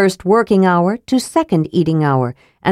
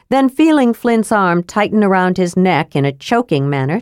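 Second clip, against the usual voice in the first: usual speaking voice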